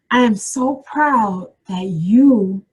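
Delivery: drawn out and emphatic, word by word; slow dictation. Shown as a demonstration. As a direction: dictate it slowly for people writing it down. Dictating a letter line to write.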